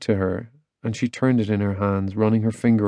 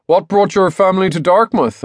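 high, louder chatty dad voice; cheery dad; 9 semitones higher, 5 dB louder than usual. A narrator's reading. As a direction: quiz the visitor in a pitched-up, sociable tone. Dad cheerily quizzes the visitor.

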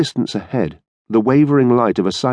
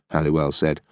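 ordinary delivery, nothing marked

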